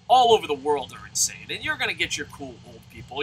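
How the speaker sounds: ordinary talk, nothing marked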